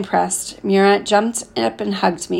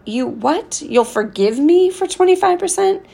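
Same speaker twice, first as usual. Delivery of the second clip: high, disbelieving squeal rising sharply; can't believe the cheek of it; incredulous; 9 semitones higher than usual. Acted. Murat stunned by the boy's demand, incredulous and high.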